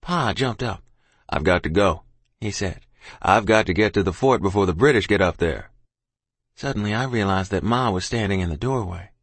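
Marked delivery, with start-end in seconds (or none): 1.3-2.8 low, firm father's line; lower, with resolve. A narrator's reading. Father jumping up to leave, firm. 3.2-5.7 low, firm father; father's voice. A narrator's reading. Father must reach the fort, firm.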